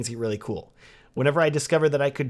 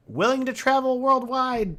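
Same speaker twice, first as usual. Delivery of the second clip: high-pitched, drawn-out, louder sloganeering; mock website slogan; 12 semitones higher, 5 dB louder than usual. Videographer parroting every website's travel promise, mock sloganeering. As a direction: proclaim it slowly and high like a cliché tagline.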